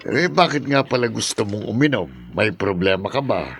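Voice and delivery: ordinary talk, nothing marked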